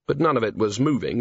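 usual speaking voice

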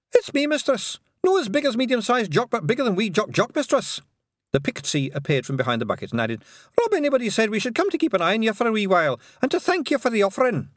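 tiny Scots pixie, quick in places. A narrator's reading. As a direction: squeak it out like a wee Feegle. Pixie character speaks.

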